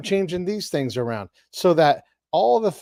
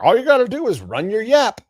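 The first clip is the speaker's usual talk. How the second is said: higher, louder mock scoff; mock dismissive; 9 semitones higher, 8 dB louder than usual. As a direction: toss it off like it's easy. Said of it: "you're like".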